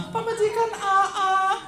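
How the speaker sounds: very high, slow, sung wail of a female ghost; bride's eerie high sing-song reply; ghostly kuntilanak voice. Acted. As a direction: croon it like a kuntilanak in the dark. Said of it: "siun kuntilanak".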